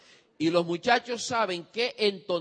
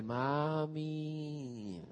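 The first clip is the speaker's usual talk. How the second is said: quiet, shivering plea of mami; pitiful child voice; 2 semitones lower, 7 dB quieter than usual. Retold or imitated. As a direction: sound freezing and helpless. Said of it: "hijo muriéndose de frío".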